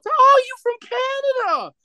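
very high-pitched, gushing imitation; mimicked excited amazement. Imitating tourist ladies amazed to meet a Canadian, excited squeal. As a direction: squeal it up high, then rush on in mock astonishment.

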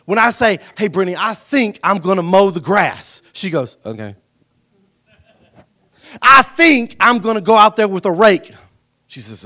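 loud, rising preacher cadence; preaching at full force. Acted. Pastor urging the church family to act, preaching hard. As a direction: preach it to the back row.

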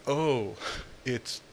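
ordinary talk

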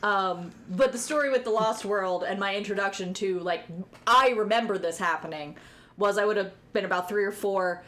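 normal talking voice

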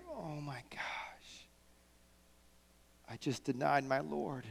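whispered, slow realization; horrified whisper. Retold or imitated. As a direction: breathe it out in shock. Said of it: "Peter's like".

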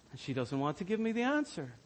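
normal talking voice

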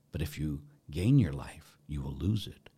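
whispered, sinking words of Jesus; hushed quote. Acted. Priest quoting Jesus in a hushed whisper. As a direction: let it fall to a whisper.

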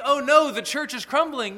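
imitating worried churchgoers in mock alarm; mock panic. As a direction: play the panicked believer, half-mocking. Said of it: "we start to panic and we begin to fear".

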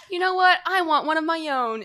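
high, loud burst of mock determination; mock sudden dad resolve. Would-be dad announcing he wants a child, mock-resolute. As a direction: declare it as a hammed-up epiphany. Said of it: "he has this energy of a guy who didn't realize".

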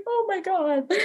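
high, hushed, choked-up gasp; tearful gasp. Real, not acted. Stranger's kind words leave her tearfully overwhelmed. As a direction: breathe it out, overcome with tears.

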